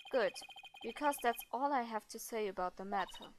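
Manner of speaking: low, subdued and dismissive; curt, reserved character. Acted. Game character refusing to discuss the matter further, low and curt. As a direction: close the subject quietly in a low voice.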